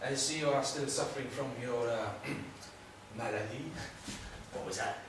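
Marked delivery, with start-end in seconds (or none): 0.1-3.7 lightly put-on French accent. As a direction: say it with a touch of French. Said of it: "I love a good accent".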